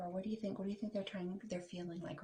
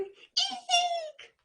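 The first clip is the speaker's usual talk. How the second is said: very high loud shriek; eek shriek; 12 dB louder than usual. Acted. Sam shrieking at the little spider. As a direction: squeal in fright.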